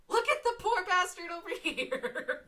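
high, loud, laughing exclamation; amused outburst. Real, not acted. Gamer laughing at a hapless player, high amused outburst. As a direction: crack up while crying it out.

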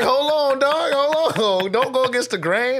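pleading with the sergeant not to punish everyone; urgent plea. Retold or imitated. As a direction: beg him to hold on, high and hurried.

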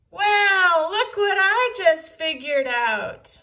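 high, loud gloating call; gloating cry. A narrator's reading. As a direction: crow it out in triumph. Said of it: "cried Big Tippy".

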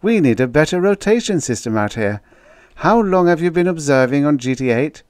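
high, loud officer's voice; earnest officer. A narrator's reading. Officer questioning the outpost man, earnest and loud. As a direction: speak up high and forcefully, officiously.

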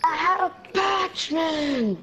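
high, loud desperate shout. Real, not acted. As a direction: yell it in sudden dismay. Character killed, patch lost.

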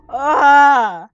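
loud yelp of frustration; frustrated yell. Real, not acted. Player groaning out loud after losing the night. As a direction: yell out in annoyance.

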